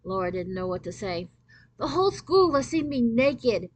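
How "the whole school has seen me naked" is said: sobbing. Acted. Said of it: "Allison sobbed".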